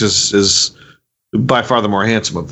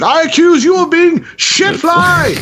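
high, slow shouted accusation; mock accusing shout; slower than usual.

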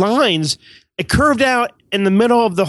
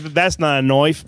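slow Australian catchphrase imitation; lower, drawn-out mock Australian delivery; mock Australian accent; 4 semitones lower, slower than usual. Requested Australian catchphrase, Australian vowel.

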